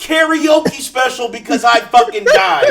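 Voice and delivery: indignant shouting. Real, not acted. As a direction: yell it in outrage. Loud indignant rant at friends.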